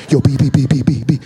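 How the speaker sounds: low, loud buddy calling out; hammed-up pal beckoning from the back; imitating friend Chris. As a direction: call your friend over, low and loud. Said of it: "he's like".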